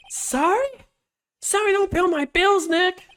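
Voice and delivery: high-pitched, hammed-up indignation; whiny, high acted outrage; indignant retort. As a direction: throw the apology back in a high voice. [name] indignantly answers an apology.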